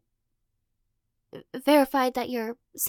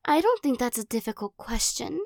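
teasing possessive captor. Acted. Captor pinning the listener against a wall, playful menace. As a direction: toy with them like a cat with a mouse.

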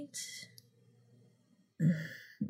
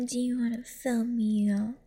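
ordinary delivery

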